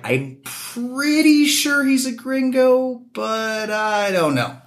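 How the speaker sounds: high, slow, doubtful local guessing; mimicking unsure local. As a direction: drag it out high like someone half-sure. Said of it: "the other quarter will be like".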